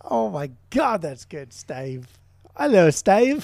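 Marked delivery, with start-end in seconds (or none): 2.6-3.4 put-on high, loud New Zealand accent greeting; mock Kiwi accent greeting his bandmate. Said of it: "that last accent was more Australian".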